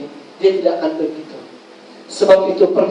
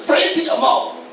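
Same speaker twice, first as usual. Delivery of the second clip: higher put-on voice of a stubborn child; stubborn refusal; 9 semitones higher, slower than usual. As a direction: refuse like a sulky kid. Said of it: "Walaupun dia keras kepala". (suara anak keras kepala yang menolak)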